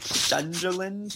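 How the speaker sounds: "Sunderland" drawn out slowly with a slushy sh for the S; speech impediment. Retold or imitated. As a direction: imitate a lisped "Sunderland", starting on sh. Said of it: "like, a speech thing".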